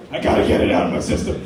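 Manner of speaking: mock stoner voice, low and quick; dazed stoned friend. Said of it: "He's like".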